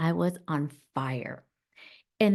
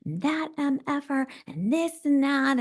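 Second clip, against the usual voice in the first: put-on higher, ranting furious teenage girl; angry teen self; 7 semitones higher than usual. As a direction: spit it out as a raging seventeen-year-old. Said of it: "She was, like, pouncing".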